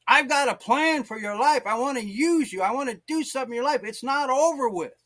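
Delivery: high, fervent proclamation; urgent preached promise. Retold or imitated. Preacher voicing Jesus to a discouraged believer, urgent and impassioned.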